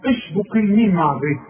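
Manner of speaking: normal talking voice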